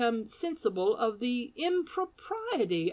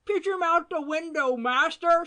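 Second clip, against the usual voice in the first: high, loud cracked scream; old woman screaming; 10 semitones higher, 6 dB louder than usual. A narrator's reading. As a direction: scream down at him. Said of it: "screamed the old woman".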